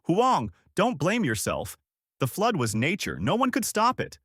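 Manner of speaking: restrained shout of comfort; mild shout. A narrator's reading. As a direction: call out insistently, pitch jumping up.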